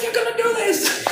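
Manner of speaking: mock shriek, extremely high; imitated screeching coworker. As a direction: mimic her insisting at the top of the range. Imitates coworker screaming demand.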